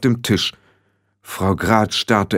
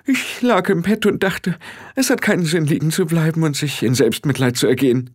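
leicht gespielte Frau, deutlich höher; hoch ansetzen, zum Ende absinken; angeschlagene Frau Grad; 8 semitones higher than usual. Acted. (hohe Stimme der angeschlagenen Frau)

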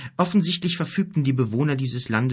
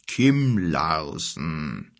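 very slow, lower, pondering repetition; thoughtful; 4 semitones lower, much slower than usual. A narrator's reading. Father repeats the guest's name, thoughtfully. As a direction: draw the name out as you mull it over. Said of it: "wiederholte Ados Vater nachdenklich".

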